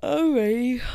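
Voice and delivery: ordinary talk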